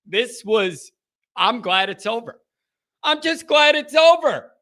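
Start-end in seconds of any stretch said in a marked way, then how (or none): 3.0-4.5 louder, higher exclamation of relief; emphatic relief. Real, not acted. Host to listeners after the game, emphatic relief. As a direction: say it louder and higher, dropping at the end. Repeats it with more force.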